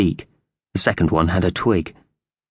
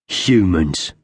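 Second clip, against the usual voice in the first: spat out. A narrator's reading. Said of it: "spat the word out".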